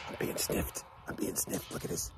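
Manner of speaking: whispered, very soft. Real, not acted. Man sniffed by a llama, whispering softly. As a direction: whisper so the animal isn't startled.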